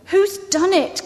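high, dismayed question; lightly acted cry of alarm; distressed cry. A narrator's reading. Susan at the broken table, distressed cry. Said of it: "cried Susan".

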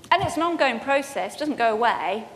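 usual speaking voice

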